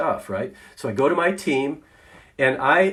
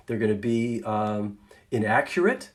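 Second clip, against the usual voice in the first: ordinary delivery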